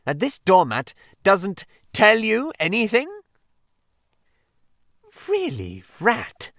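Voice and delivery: exasperated. Acted. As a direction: spell it out in disbelief. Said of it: "cried the exasperated Rat".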